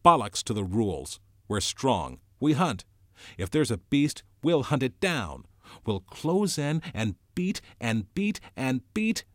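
loud, defiant war cry; shouting. A narrator's reading. Jack shouts against Ralph about hunting, defiant. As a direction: shout it down defiantly, pitch climbing. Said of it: "Jack was shouting against him".

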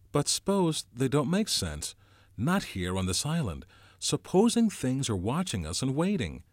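put-on uneasy boy Ralph. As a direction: voice a creeping dread. Ralph voiced, fearful.

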